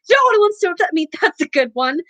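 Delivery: howling. A narrator's reading. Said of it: "howled Myrtle".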